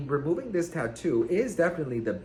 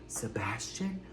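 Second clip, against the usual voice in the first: whispered greeting; 4 semitones lower, 9 dB quieter, slower than usual. Retold or imitated. Ex greets her at club.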